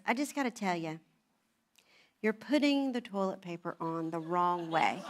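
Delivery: low, softened, solemn delivery; deadpan mock gravity, dropped low; very serious. Husband breaking toilet paper news to wife, mock solemn. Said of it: "was very serious".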